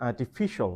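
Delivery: "artificial" with FI stressed, "ar-ti-FI-shul"; stress third syllable. Shown as a demonstration. Teacher showing where you have to stress, third-syllable stress. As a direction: lean on the "fi" in "artificial". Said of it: "you have to stress".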